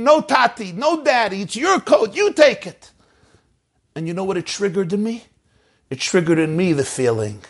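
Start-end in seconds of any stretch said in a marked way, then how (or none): 0.0-2.9 high, insistent pleading; son's mock-screamed refusal; retold scream. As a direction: insist in a raised pitch that he take it. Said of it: "And the son is screaming".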